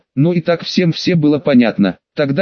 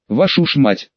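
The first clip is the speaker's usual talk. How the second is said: ordinary delivery, nothing marked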